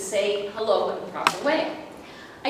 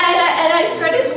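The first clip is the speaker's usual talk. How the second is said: loud, higher, laughing hard while quoting; can barely get the words out for laughing; retold line broken up by laughter; laughing through the story; 7 semitones higher, 9 dB louder than usual. Retelling daughter's embarrassing greeting.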